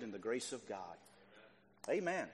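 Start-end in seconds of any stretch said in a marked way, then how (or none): none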